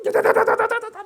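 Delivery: high, loud rattling "da-da-da" burst; mock battle rattle; rapid-fire battle noise. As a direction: imitate rapid fire with your voice. Imitates weapons firing in battle.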